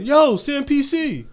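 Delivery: high, slow drawn-out shout; excited fan call. Retold or imitated. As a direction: call out like you spotted a celebrity. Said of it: "they're screaming".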